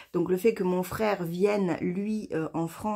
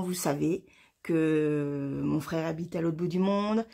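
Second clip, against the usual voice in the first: ordinary talk, nothing marked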